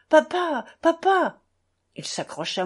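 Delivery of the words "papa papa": high, loud cry of papa; boy crying out. Retold or imitated. Schoolboy to father being dragged, loud cry. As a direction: call out for your father in alarm. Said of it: "en criant".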